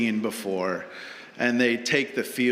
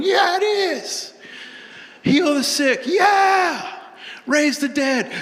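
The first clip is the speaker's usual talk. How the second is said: high chanting team call-and-response; hyped team shouting; 5 dB louder, slower than usual. Pretend. Team roaring back at the coach's pep talk, shouting.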